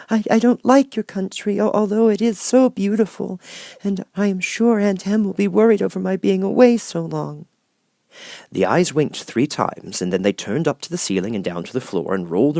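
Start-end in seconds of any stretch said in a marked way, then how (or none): 0.1-7.5 put-on much higher small girl's voice; a meek little girl finding courage; little Dorothy. Dorothy pleads with Oz.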